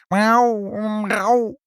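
high, loud imitated miaow; cat meow. A narrator's reading. Cat calls out in story.

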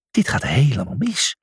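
unvoiced despairing whisper. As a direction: whisper it in dismay. Julius hides his head, despairing.

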